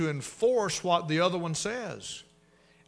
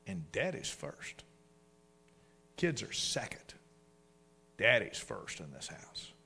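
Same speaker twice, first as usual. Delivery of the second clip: hushed emphasis; 4 semitones lower, 7 dB quieter, slower than usual. Real, not acted. Preacher lowers voice for emphasis.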